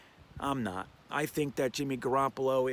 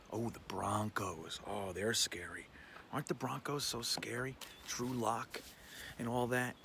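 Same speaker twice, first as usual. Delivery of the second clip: hushed, much softer feigned dread; mock-scared sarcasm; 4 semitones lower, 10 dB quieter than usual. Fan mocking the Broncos as scary, hushed sarcasm. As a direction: drop low and soft, pretending to be impressed.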